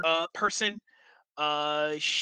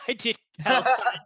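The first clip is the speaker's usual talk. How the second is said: laughing; 5 semitones higher, 5 dB louder than usual. Real, not acted.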